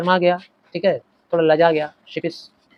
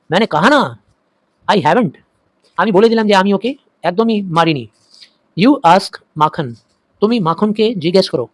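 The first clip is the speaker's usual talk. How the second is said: loud, raised protest; insistent outburst; 3 semitones higher, 6 dB louder than usual. Acted. Fatik insists to his mother he never hit Makhan, loud. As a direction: blurt it out, louder. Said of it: "Sphatik said suddenly".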